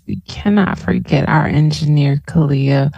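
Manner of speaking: ordinary delivery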